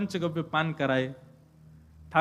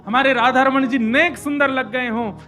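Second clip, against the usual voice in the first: raised, louder impassioned preaching; full-force preaching; 5 semitones higher, 8 dB louder than usual. Acted. Preacher urging listeners to cherish Vrindavan, impassioned. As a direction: pour your heart into praising Vrindavan.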